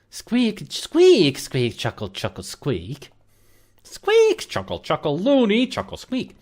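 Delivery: jumpy high squeaks and chuckles; squeaky ferret babble. Pretend. Voicing the ferret.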